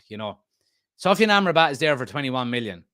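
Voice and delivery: usual speaking voice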